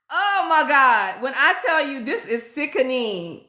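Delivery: ordinary delivery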